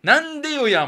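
Okinawan phrase. Pretend. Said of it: "もいいよな".

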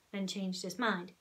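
ordinary delivery

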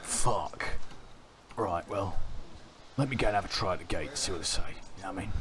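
put-on London geezer accent; casual cockney lad voice; British accent. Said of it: "keep this voice up".

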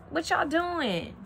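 slow, high Southern drawl on "y'all doing"; Southern country drawl. Pretend. Tarot reader greets a Southern viewer in a slow country drawl. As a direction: stretch it like a country relative greeting. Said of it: "is somebody Southern country over here".

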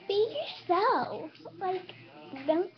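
high, drawn-out fake voice; slow, high-pitched and obviously fake. Shown as a demonstration. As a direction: put on a high, exaggerated phony voice. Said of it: "talk in a fake voice like this".

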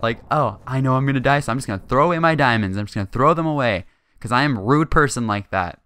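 loud mock voice of a jerk; mocking imitation. Gamer mimics the player who threw away his diamonds, mocking. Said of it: "People like that are just jerks".

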